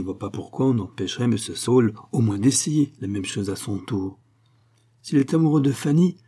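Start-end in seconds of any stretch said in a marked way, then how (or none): 5.0-6.2 mildly naive, higher-pitched question; lightly voiced innocence. A narrator's reading. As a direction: voice a guileless young remark, lifted in pitch. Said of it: "dit naïvement Marie".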